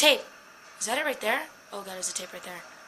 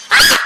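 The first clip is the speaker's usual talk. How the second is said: sudden, very loud scream; startled scream; 14 dB louder than usual. Real, not acted. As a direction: scream from a jump scare. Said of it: "I screamed like a bitch".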